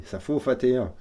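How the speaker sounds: put-on joking German accent, V turned to F; playful German-accented French, hardened consonants; mock German accent. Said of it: "parfois j'ai du mal à, à perdre mon accent allemand".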